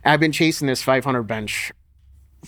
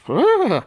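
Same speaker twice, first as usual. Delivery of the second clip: disgusted groan; 13 semitones higher, 5 dB louder than usual. Retold or imitated. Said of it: "my wife is here like".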